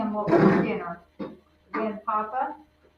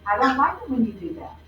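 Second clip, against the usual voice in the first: firm stop; 3 semitones higher than usual. Shown as a demonstration. Teacher modelling how to tell a bully to stop, firmly. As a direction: say it firmly, showing kids how. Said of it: "look at someone and say".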